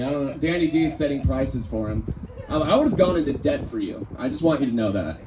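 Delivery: ordinary talk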